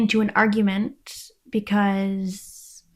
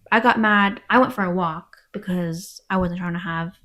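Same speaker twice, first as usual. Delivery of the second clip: normal talking voice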